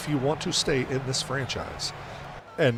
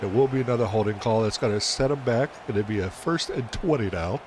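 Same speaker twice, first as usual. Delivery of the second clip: ordinary delivery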